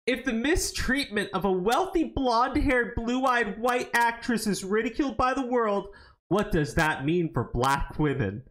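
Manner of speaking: mocking the article, pitched well up; mocking read. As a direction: read it high and mocking, scornful of every word. Reading the article's line mockingly.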